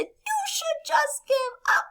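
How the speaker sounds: very high, slow bird voice; squeaky storybook taunt; mocking little birds. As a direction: jeer like a tiny bird. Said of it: "they laugh at Toad and they say".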